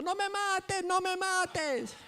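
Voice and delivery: put-on high, loud, slow child's scream. Little girl running from her father, screaming high. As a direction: squeal it like a fleeing little girl. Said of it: "ella va gritando".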